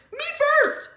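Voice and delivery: high, loud shout; loud cry. A narrator's reading. Piglet shouting while racing toward the voice. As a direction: yell it as he runs. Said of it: "cried Pinkerton".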